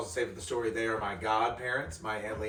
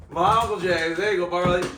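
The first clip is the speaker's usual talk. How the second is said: calling out; 7 semitones higher, 10 dB louder than usual. Real, not acted. Calling out to the dog.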